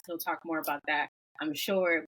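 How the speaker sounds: normal talking voice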